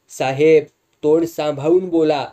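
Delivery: loud, sharp warning; indignant rebuke. A narrator's reading. Sundar warning the boss who insulted them, indignant rebuke. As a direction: stand up to him, raising your voice.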